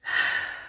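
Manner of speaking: deep breath out. Shown as a demonstration. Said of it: "deep breaths in and deep breaths out".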